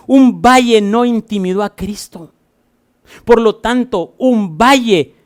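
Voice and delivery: rally the congregation against fear; fiery preaching. Acted. Pastor urging the church not to fear the valley, fiery preaching.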